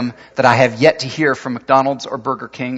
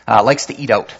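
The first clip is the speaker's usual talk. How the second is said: usual speaking voice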